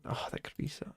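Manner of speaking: hushed whisper. Real, not acted. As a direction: whisper it under your breath. Shocked realization while watching.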